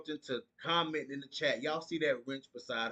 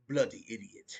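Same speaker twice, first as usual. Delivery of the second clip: imitated British accent; 3 semitones lower than usual. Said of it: "Stamina just mumbled to herself".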